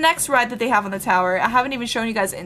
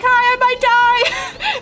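mock rider's wave; 11 semitones higher, 4 dB louder, much slower than usual. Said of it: "he's like".

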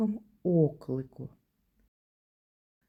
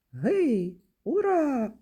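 1 cheering; 6 semitones higher, 4 dB louder, slower than usual. Acted. Exclamations in the poem.

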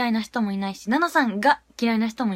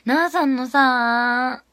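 very slow, louder drawn-out gush; 5 dB louder, much slower than usual. Real, not acted. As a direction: stretch it out fondly. Gushing about Nana.

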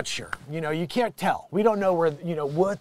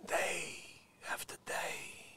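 soft breathy whisper, much softer; 18 dB quieter, slower than usual. Pretend. Vlogger musing quietly to viewers, whispered. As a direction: hush it like a secret.

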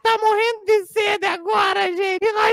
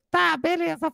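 ordinary talk, nothing marked

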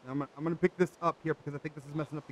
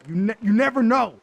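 "never know" pitched up and louder; emphatic warning; 4 semitones higher, 8 dB louder than usual. Real, not acted. Player warning viewers about fast food kitchens, emphatic. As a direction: stress "never know" loudly.

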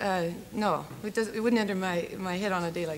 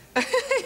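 short burst of laughter, high and loud; bright laugh; 7 dB louder than usual. Real, not acted. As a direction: laugh out at the joke. Guest laughs at "Messy" quip.

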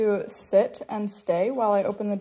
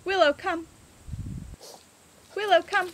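loud high call across the yard; calling the dog; 8 semitones higher, 5 dB louder than usual. Real, not acted. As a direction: call her in, bright and loud.

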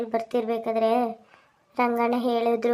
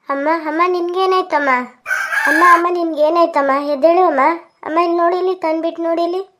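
loud, high alarmed pleading; panicked; 6 semitones higher, 8 dB louder than usual. Acted. Son to his fainted mother, panicked cries. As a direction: plead with her urgently to wake.

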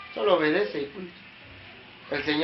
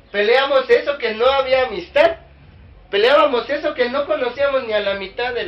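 preaching at full force; 6 semitones higher, 7 dB louder than usual. Real, not acted. Preaching climax about former churches.

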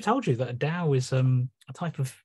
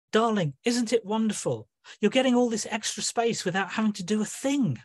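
higher, gushing mock-sweet voice; Gaia quoted; 8 semitones higher than usual. Neptune mimicking Gaia's message, mock-sweet higher voice. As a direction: coo it like a falsely cheerful friend. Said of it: "Gaia WhatsApped".

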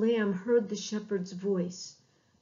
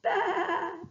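lamb's bleat; 9 semitones higher than usual. Acted. Lamb crying near wolf.

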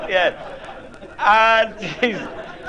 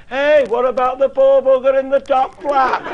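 mock heckle shouted from the back, higher and louder; calling out; 7 semitones higher, 5 dB louder than usual. A daft bloke at the back calling out at the demolition, mock shout. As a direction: yell it like a cheeky bloke at the back of a crowd.

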